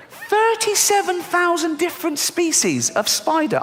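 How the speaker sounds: slow, very high incredulous squeal; high-pitched disbelief. Acted. Comedian appalled at spider numbers, slow squeaky disbelief. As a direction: repeat it way up high, drawn out, aghast.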